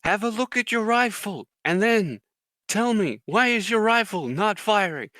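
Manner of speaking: loud, high-pitched mock sergeant demand; drill sergeant. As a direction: imitate a drill sergeant grilling a recruit. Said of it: "he's like".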